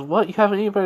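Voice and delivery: a mother catching her son skipping breakfast; surprised mom. Acted.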